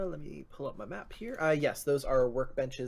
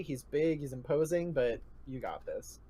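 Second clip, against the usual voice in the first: ordinary delivery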